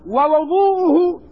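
"ووضوءه" as wa-wu-DOO-u-hu; rounded wu- after the "and" in "ووضوءه"; damma on the waw. Shown as a demonstration. Teacher naming the act of ablution, damma on the waw. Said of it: "بضم الواو".

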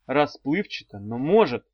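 usual speaking voice

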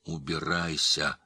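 slow, strained narrated order; a touch of a wounded croak, drawn out; hoarse order. A narrator's reading. Wounded father sends his daughter away, slow hoarse order. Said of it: "прохрипел отец".

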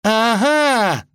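high, loud triumphant exclamation; gloating. Acted. Vasilisa catches sight of the wolf, gloating. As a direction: pounce gleefully in a high voice. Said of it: "злорадно воскликнула она".

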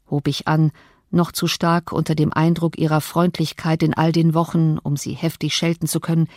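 plain reading voice

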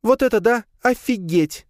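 high, amazed creature cry; excited shout. A narrator's reading. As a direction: shriek in delight. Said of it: "ментальный крик Леи". (восторженный крик)

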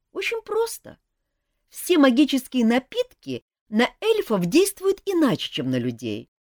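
put-on young elf prince. A narrator's reading. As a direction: confess it seriously but eagerly. Принц объясняет про кровь. (молодой принц-эльф, серьёзно, выше и громче)

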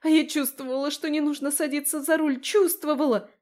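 high-pitched agitated wailing; panicked lament. Acted. Friend wails in panic.

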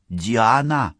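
ordinary delivery

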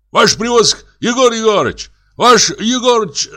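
high-pitched call across a distance; shouting to others. A narrator's reading. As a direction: hail the other hunting party urgently. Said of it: "закричала вторая группа". (высокий протяжный окрик издалека)

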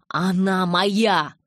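loud, slow, possessive outburst; angry claim. A narrator's reading. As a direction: stretch each word out, loud and angry. Furious quarrel over her daughter.

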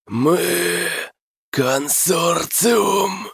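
high, slow inhuman creaking voice; eldritch horror. Acted. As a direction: creak it out like a monster. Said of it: "проскрипело оно своим нечеловеческим голосом".

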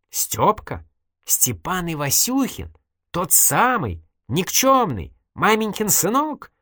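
high-pitched, disbelieving questions; astonished double-take, pitch climbing at the end; incredulous. A narrator's reading. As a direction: check each word in growing disbelief. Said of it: "недоверчиво переспросил Дима".

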